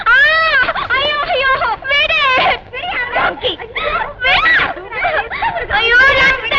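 frightened shrieks of protest; panicked screams. Acted. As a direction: scream to be let go. Woman grabbed, screams for help.

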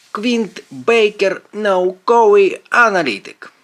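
high, slow title reveal; drawn-out, self-important announcer delivery; grand announcement. A narrator's reading. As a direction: proclaim it like a hero's title. Friend announces his own grand name.

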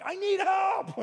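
very high, loud strained cry for help; desperate shout. Retold or imitated. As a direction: give in as the weight drops. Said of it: "I'm like".

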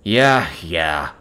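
lightly voiced sarcastic snarl, low at the end; understated snarl. A narrator's reading. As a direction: snarl it with restraint, dropping away. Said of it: "he snarls".

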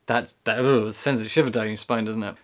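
normal talking voice

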